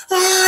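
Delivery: high-pitched imitated scream; mock scream. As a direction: cry out in mock panic. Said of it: "he's screaming".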